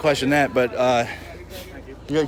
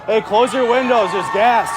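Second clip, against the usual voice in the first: calling out; 6 semitones higher, 3 dB louder than usual. Real, not acted. Warning residents about tear gas.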